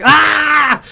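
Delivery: high-pitched mock scream of exasperation; frustrated scream. Salon colorist overwhelmed by percentages in her head, exasperated scream. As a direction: cry out at impossible sums, drawn out and high. Acting out frustration over odd numbers.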